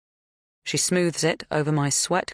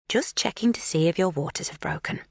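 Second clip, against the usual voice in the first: calm midwife tone; 4 semitones higher than usual. A narrator's reading. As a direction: soothe her like a midwife. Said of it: "calm and reassuring".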